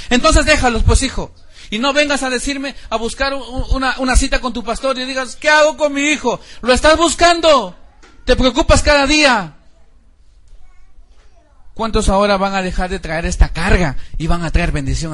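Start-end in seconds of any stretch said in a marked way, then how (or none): 0.1-9.6 raised, scolding preacher voice. Acted. Pastor rebuking parents who neglect their kids, loud scolding. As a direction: rebuke the careless parents loudly.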